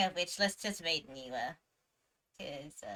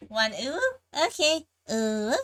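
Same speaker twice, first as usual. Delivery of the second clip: ooh; 4 semitones higher, 10 dB louder than usual. Shown as a demonstration. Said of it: "That's your ooh".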